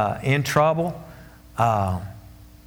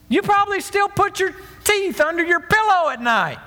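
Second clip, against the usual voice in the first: mocking scoffer. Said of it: "People will say".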